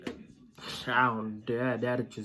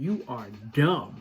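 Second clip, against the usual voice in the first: normal talking voice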